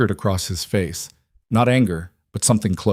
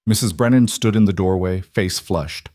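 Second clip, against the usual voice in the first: plain reading voice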